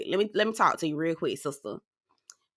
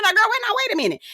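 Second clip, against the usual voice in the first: alarmed halt; 13 semitones higher, faster than usual. Retold or imitated. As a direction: stop her in disbelief. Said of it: "I said".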